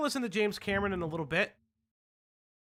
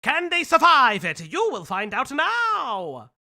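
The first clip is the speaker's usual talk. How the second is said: put-on loud, higher old-time Mid-Atlantic accent announcer; 6 semitones higher, 8 dB louder, slower than usual. Shown as a demonstration. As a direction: boom it out like a 1940s newsreel with a Mid-Atlantic accent. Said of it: "Atlantic accent is like".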